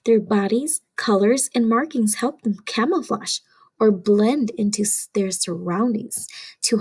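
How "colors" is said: ordinary delivery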